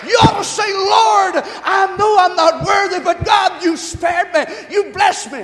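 high, loud shouted exhortation; impassioned pulpit shout; full-force preaching. Real, not acted. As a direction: urge the congregation into praise at full volume.

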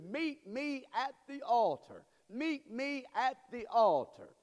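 slow, high-pitched ringing appeal; preacher's altar call. Real, not acted. Preacher summons the congregation to the altar, high, slow appeal. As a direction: call them forward, drawing the words out.

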